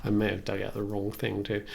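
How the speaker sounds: ordinary talk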